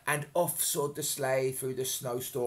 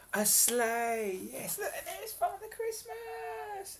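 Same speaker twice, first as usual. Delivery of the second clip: high, slow, delighted pointing out; wide-eyed wonder at the sleigh; excited; slower than usual. Acted. Showing a child the picture, high excited delight.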